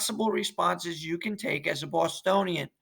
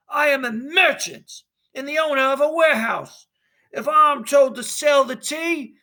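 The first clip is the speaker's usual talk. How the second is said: a merchant waving off responsibility, higher and louder; stiff merchant; 7 semitones higher, 4 dB louder than usual. Acted. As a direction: end the conversation. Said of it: "Clark makes an exit".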